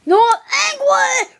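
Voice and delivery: loud, rising play-acted rage; pretend angry shout. Playing the angry bird, high angry shout. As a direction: yell it high like a raging cartoon bird.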